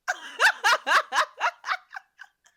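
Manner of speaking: long high cackle of laughter; loud laugh. Real, not acted. Laughs after asking question.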